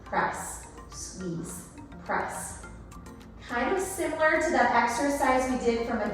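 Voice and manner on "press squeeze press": breathy whispered rep cues. Shown as a demonstration. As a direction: whisper the cue words. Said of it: "whispered cue words".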